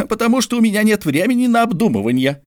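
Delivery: high, fast exasperated explanation; Mordecai exasperated. A narrator's reading. Mordecai explains to George, high and fast. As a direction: rush it out, pitched high.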